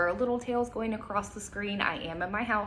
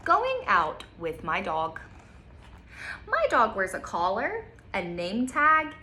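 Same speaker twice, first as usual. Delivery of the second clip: bright storybook reading, slow on the details; reading to children; 3 semitones higher, slower than usual. Acted. Teacher reading a picture book to kids, lively storytime voice. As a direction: read it aloud like storytime.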